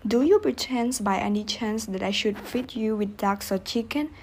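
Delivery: plain reading voice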